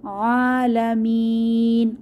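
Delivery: আলামিন with the last long vowel held before stopping; chanted, slow; three-alif stretch. Shown as a demonstration. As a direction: stretch the final vowel of আলামিন three counts, then stop. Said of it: "তিন আলিফ টেনে ওয়াকফ করব".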